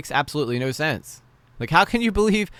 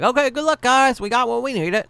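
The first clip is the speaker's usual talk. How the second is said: high-pitched, louder mocking send-off; mock cheery sign-off; 12 semitones higher, 5 dB louder than usual. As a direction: imitate them gleefully skipping out, voice way up. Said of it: "And then were they like".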